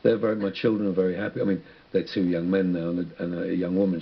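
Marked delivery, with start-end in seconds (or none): none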